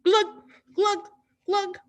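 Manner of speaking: high playful underwater glug; glug sounds. Shown as a demonstration. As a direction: bubble noises like a fish. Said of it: "so they go".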